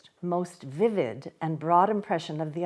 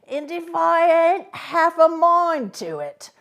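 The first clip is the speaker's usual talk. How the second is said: higher and louder, menacing; threatening convict; 8 semitones higher, 7 dB louder than usual. A narrator's reading. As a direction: convict threatening to eat the boy. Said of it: "threatening shake of his head".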